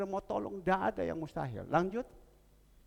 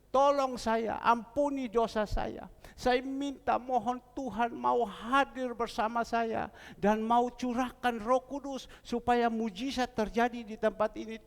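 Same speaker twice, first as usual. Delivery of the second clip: higher, fervent petition; retold pleading prayer; 7 semitones higher than usual. Husband crying to God for the Holy Spirit to come. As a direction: pray urgently for a miracle. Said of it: "Saya berteriak".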